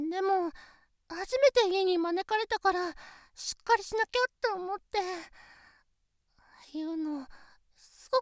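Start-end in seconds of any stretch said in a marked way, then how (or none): none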